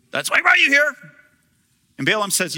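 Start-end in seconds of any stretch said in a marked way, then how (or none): 0.1-1.0 eager Balak. Retold or imitated.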